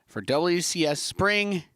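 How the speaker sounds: big esports show opener; announcer voice. Acted. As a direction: announce the welcome with flair. Opening the broadcast.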